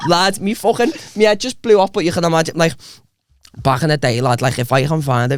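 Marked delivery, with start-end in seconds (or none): none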